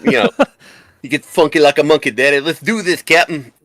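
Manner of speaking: high, quick wrestler's promo voice; Dusty Rhodes. Acted. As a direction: hype it up like a showboating wrestler. Plays Dusty Rhodes facing Kirk.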